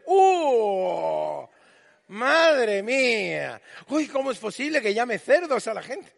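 exclamación fuerte, alargada; escándalo burlón. Retold or imitated. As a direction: finge horror exagerado. Haters escandalizados con Jesús. (escándalo fingido de los haters, más fuerte)

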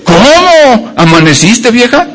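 high and slow, dragged out after thirty years; weary drawn-out greeting. Retold or imitated. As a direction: stretch it out like a tired old husband. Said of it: "nada más que cambia el tono". (saludo lento y cansado, alargado)

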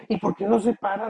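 voz mucho más aguda y más fuerte; pregunta imitada. Retold or imitated. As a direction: pregunta con extrañeza fingida. Diálogo imitado sobre el perro. (persona curiosa imitada, voz aguda)

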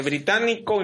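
usual speaking voice

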